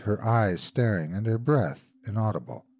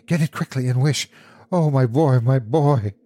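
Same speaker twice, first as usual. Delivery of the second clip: higher, urgent, breaking into a loud lament; panting urgency; 4 semitones higher, 5 dB louder than usual. A narrator's reading. Mother begging for her dead boy's return, panting urgency. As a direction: plead desperately for her child. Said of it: "she panted".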